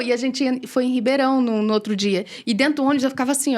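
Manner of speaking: ordinary delivery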